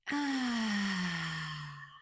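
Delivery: long falling open-mouthed exhale; deep releasing sigh. Shown as a demonstration. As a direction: breathe in deeply, then sigh it all out. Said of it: "Another deep breath in".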